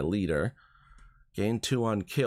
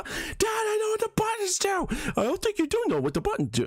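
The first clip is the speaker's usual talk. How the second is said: exasperated son. Retold or imitated. Son snapping back at dad.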